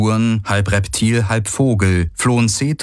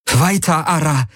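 much higher, urgent order; shaky command; 8 semitones higher than usual. A narrator's reading. Kirai orders Ara onward, higher and shaken. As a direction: order him on, voice trembling. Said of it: "befahl Kirai ihm mit bebender Stimme".

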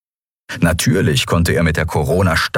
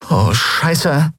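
higher, lightly voiced young woman swearing; a dry shocked curse at the descending ship; Endriel's curse; 9 semitones higher than usual. Endriel sees the pirates land, dry curse. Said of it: "sagte Endriel".

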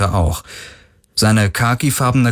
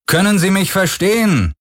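high, raised call to strangers; firm leader's call; 12 semitones higher than usual. A narrator's reading. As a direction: call across in a firm, lifted voice. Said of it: "seine Stimme fest".

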